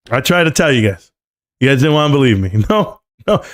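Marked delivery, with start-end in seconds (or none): none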